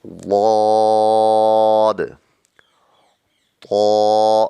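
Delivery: ضاد long, loud and full; heavy dhad. Shown as a demonstration. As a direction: press from the tongue's side. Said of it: "زبان کی کروٹ ... سے ادا ہوتا ہے".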